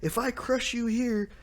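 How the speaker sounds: a hammed-up challenge called out high; mock yell. Kid arriving at the capital, calling out Luffy, mock yell. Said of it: "and he yells".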